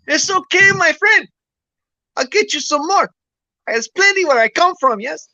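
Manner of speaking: put-on high jokey accent for the Mexican; Mexican character voice. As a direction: play the generous Mexican in the boat.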